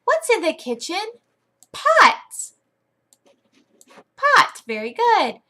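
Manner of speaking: put-on high, animated kids'-class voice; exaggerated, loud high-pitched classroom prompting; playful teacher. Shown as a demonstration. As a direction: act thrilled to quiz a little pupil. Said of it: "you can say".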